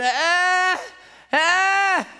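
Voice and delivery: put-on high wordless toddler wail; imitated frantic child's cry. Father imitates his frantic little boy, high crying wail. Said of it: "he's crying frantically".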